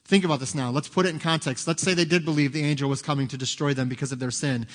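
ordinary delivery

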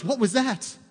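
acted-out puzzled alarm; startled stepdad. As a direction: react as a baffled stepdad hearing a strange voice.

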